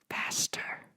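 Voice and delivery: hissed urgent whisper; menacing whispered push; whispered command. A narrator's reading. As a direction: breathe the order close to his ear. Teacher leaning over, urging.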